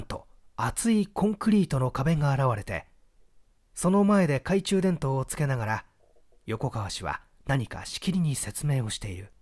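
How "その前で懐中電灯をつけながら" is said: ordinary delivery, nothing marked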